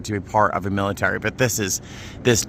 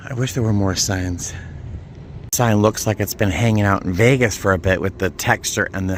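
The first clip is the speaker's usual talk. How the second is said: ordinary delivery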